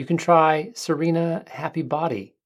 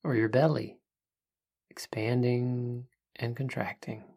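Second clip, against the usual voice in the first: soft spa whisper; 5 dB quieter than usual. Acted. Leads a breathing meditation.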